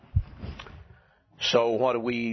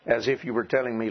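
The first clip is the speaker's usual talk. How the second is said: ordinary talk, nothing marked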